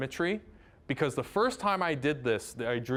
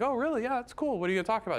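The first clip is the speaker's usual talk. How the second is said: excited; 8 semitones higher, much faster than usual. Retold or imitated. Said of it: "And I was like".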